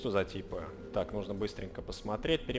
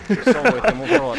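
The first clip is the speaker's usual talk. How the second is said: surprised; 14 semitones higher, 13 dB louder than usual. Real, not acted. Reacting to odd game cutscene.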